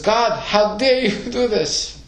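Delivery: high, slow, half-laughing mock outrage; mock outraged Abraham. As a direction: play Abraham daring to scold God, with a chuckle. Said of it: "So he says".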